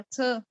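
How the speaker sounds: थ alone, a small puff of released T; aspirated T sound. Shown as a demonstration. Teacher isolating the sound for learners, थ as a light puff. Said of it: "थोड़ा सा मैंने थ कर दिया".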